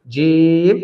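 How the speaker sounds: জিম with a long, held vowel; drawn-out letter name. Shown as a demonstration. Teacher naming a letter for learners, drawn out. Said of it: "টেনে বলতে হবে".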